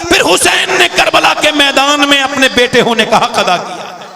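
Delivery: fiery preacher shouting to crowd; booming political rally speech. Acted. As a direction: build to a roaring climax. Sermon climax, high and loud.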